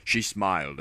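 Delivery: ordinary delivery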